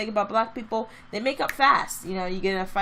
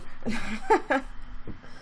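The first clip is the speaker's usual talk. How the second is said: breathy laugh; 4 dB quieter than usual. Real, not acted. Laughing at own impression.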